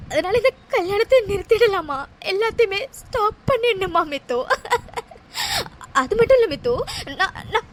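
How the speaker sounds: sobbing out the words; heartbroken, crying it all out; tearful, despairing; weeping. Acted. Heartbroken woman weeps.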